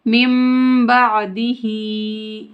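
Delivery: मिन बादिही with n turned into a humming m; ghunna merging. Shown as a demonstration. Teacher showing mim joined to mim, humming मिन बादिही. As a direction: chant "mimm-ba'dihee" for मिन बादिही, slowly. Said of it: "मीम को मीम से मिलाकर पढ़ेंगे".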